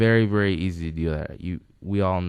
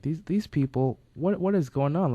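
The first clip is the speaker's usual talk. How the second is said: higher-pitched baffled onlooker; mock bewilderment; 9 semitones higher than usual. Outsider watching a yelling family, mock bewilderment. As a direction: act out mild disbelief, pitched up.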